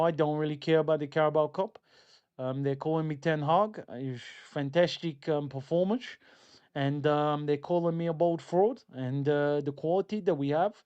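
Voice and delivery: mock Dutch accent; Ten Hag. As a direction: Dutch accent with slushy S sounds, hesitant ums. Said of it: "That's my Dutch accent".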